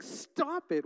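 retold yell. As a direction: imitate a dad's scolding yell without full volume. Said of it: "I was yelling at him".

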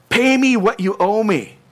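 high, loud, grabbing-by-the-throat demand; a creditor choking his debtor for cash; angry demand. Acted. Servant seizing a fellow servant by the throat, angry loud demand. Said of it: "took him by the throat, saying".

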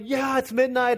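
high, gleeful imitation of children; excited kids. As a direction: cheer like a thrilled four-year-old. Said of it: "they're so excited".

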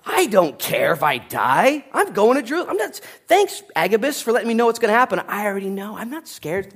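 high-pitched mock outburst; defiant yelling. Paul brushing off his crying friends, defiant high outburst. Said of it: "And Paul yelled at him".

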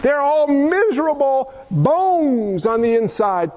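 ordinary talk, nothing marked

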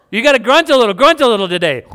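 loud, high, teasing urge to grunt; mock-pleading with Lydia, loud and bright; playful coaxing. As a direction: egg her on, half laughing.

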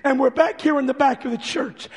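ordinary talk

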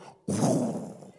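imitated gush of projectile vomit; fire hose whoosh. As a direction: blow a long spraying whoosh. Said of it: "like a fire hose and go".

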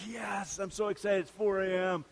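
a mock early riser, giddy at four a.m. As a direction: play the hyped early riser. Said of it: "excited at four A M".

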